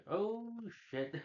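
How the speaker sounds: high, drawn-out oh of dismay; stretched, high-pitched mock alarm. Loki reacts with dismay in retelling.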